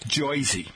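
"Jersey" as JOY-zee; mock local pronunciation. Shown as a demonstration. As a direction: say "Jersey" with an "oy" vowel. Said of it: "calls it".

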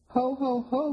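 high jolly Ho ho ho; jolly Santa greeting; hearty Santa laugh. A narrator's reading. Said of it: "he said heartily".

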